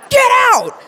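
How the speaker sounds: loud, high barked order; furious doctor shouting; angry shout. Retold or imitated. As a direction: yell him out of the room. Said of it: "doctor shouting again".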